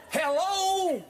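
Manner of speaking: high, drawn-out hello; sing-song catchphrase delivered high; catchphrase jingle. Shown as a demonstration. Coach giving co-host his jingle, hammed-up hello. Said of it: "I'm gonna give you the jingle".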